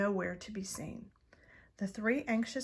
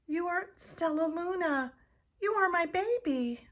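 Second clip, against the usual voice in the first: higher, rising joy; mother bat, overjoyed; 9 semitones higher, 4 dB louder than usual. A narrator's reading. Mother Bat finding her lost baby, higher and glad. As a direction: recognise your lost child. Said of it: "she whispered".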